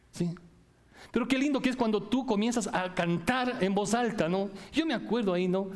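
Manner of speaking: ordinary delivery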